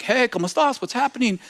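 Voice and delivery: an eighty-year-old man asking the visitors, lightly voiced. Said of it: "this old man comes out of the back".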